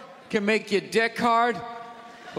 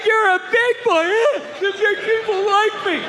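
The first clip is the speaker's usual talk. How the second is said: put-on much higher, louder sing-song praise to a child; patronizing adult; 11 semitones higher, 6 dB louder than usual. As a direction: coo at a little boy mockingly.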